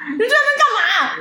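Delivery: laughing coworker. Retold or imitated. Coworker mocking the pointless trip, high laughing question.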